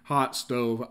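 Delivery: usual speaking voice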